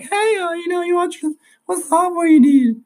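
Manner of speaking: high falsetto office lady; squeaky put-on woman's voice, rising in volume; girly voice. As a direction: falsetto imitation of an older lady at a desk. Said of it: "my girly voice".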